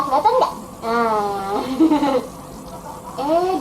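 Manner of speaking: ordinary delivery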